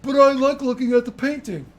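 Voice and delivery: put-on high, loud childish whine; Bastian whining. Bastian whines that he likes the painting. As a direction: whine like a sulking boy.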